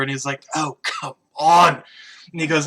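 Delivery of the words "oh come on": drawn-out groan of protest, louder at the end; slow, exasperated bartender's complaint. Acted. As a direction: stretch it out, fed up with a drunk. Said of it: "the bartender, kinda runs over".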